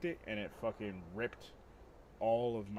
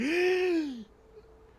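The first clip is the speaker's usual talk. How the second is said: loud, high desperate gasp for breath; waking gasp; 10 dB louder than usual. Retold or imitated. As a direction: gasp like you just woke up choking. Waking up choking.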